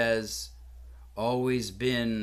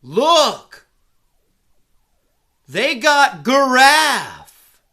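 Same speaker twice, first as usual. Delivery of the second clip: much higher, loud excited yell; 11 semitones higher, 15 dB louder, much slower than usual. Retold or imitated. Father yells to family at the zoo, excited shout. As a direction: shout it across the zoo like a thrilled yokel. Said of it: "who yelled out".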